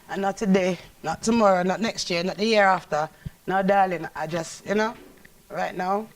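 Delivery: a Jamaican Patwa rejection of a marriage proposal. Acted. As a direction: turn him down in Jamaican Patwa. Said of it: "say something to us or to the camera in Patwa".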